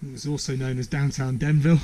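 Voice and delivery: ordinary talk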